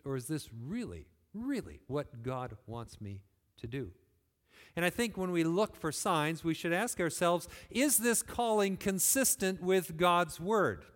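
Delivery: normal talking voice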